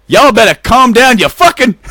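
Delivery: irate neighbor. Acted. Imagined neighbor yelling by the window, hammed-up outrage. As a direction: holler it like a fed-up neighbor. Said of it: "he's all like".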